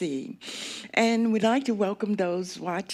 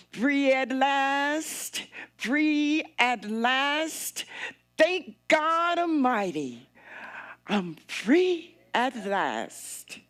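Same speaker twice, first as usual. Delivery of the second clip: Martin Luther King; 5 semitones higher, slower than usual. Retold or imitated. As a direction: ring it out like a civil rights orator. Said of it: "in the words of Martin Luther King".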